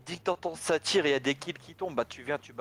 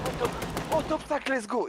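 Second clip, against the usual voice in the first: excited; 9 semitones higher than usual. Real, not acted. Celebrating a good placement.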